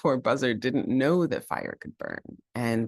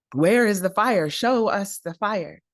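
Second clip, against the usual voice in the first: eager demand; 8 semitones higher, 4 dB louder than usual. Acted. Animals demanding.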